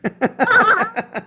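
high-pitched peal of laughter; amused high giggling burst; real laughter. Real, not acted. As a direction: laugh at your own joke. Laughing at own joke.

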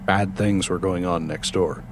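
usual speaking voice

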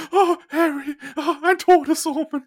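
high, mystical seer voice, slowing down; prophesy doom in a dreamy high voice; fortune teller. Retold or imitated. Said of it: "jedes Mal die Lehrerin nur so".

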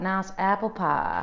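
slow Southern drawl, "nahs apple pah"; Southern accent. Shown as a demonstration. Guest demonstrating her drama role accent, Southern accent. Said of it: "So for Southern".

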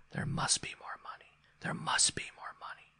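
soft, voiceless haunting whisper. A narrator's reading. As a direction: whisper like a secret from the rocking horse. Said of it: "It came whispering from the springs".

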